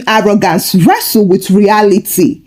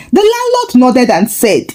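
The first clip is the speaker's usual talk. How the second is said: ordinary delivery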